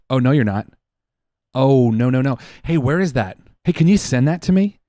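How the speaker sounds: hammed-up husband who suddenly wants the video; eager husband. Husband pressing wife to send the squirrel video, mock loud. Husband voiced, getting worked up.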